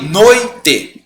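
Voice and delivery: noite as "noi... te"; two distinct beats in noite, oi together; syllable split. Shown as a demonstration. Teacher recapping the division, noite as noi... te. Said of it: "Então eu separei".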